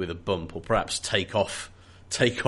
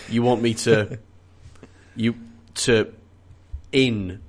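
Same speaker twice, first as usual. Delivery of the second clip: halting, word by word with long pauses; stunned disbelief; slower than usual. Acted. Worker handed ludicrous job, halting in disbelief. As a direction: grope for any way out. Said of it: "some way to kind of, to kind of express in sound".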